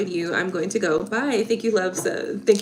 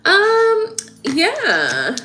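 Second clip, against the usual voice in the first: emotional; 13 semitones higher, 6 dB louder than usual. Real, not acted. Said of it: "I'm very emotional".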